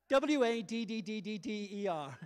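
mock-spell the American way to say water; exaggerated American. Shown as a demonstration. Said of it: "you've gotta communicate".

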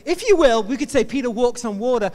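normal talking voice

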